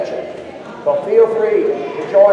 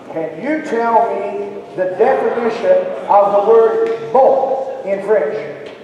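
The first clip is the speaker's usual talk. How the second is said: drawn-out question, bon stretched very slowly; slow it right down like a teacher; slow teacher quiz; slower than usual. Shown as a demonstration. Performer quizzing the crowd on French, slow and drawn out. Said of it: "teacher quizzing the audience".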